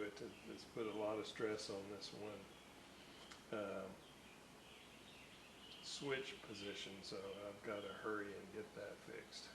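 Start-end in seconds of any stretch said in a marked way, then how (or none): none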